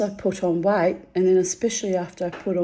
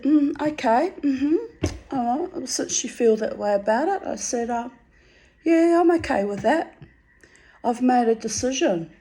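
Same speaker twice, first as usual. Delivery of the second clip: mock sweet resolve; 6 semitones higher than usual. Her icy reply to husband.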